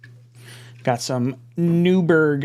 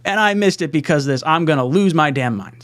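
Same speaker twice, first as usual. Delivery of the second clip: retold anger; 6 semitones higher than usual. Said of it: "was I mad".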